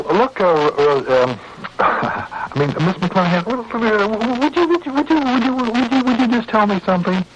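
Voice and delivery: high, panicky stutter with a forced little laugh; flustered, squeaky-high pleading; nervous stammering. Acted. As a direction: stumble over the words as your voice climbs. Stammering nervous question to sweetheart.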